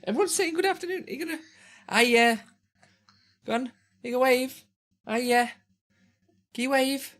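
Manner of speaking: high, quick coaxing voice; coaxing his son to wave at chat. Real, not acted.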